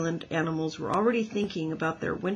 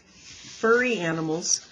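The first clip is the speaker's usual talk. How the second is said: ordinary delivery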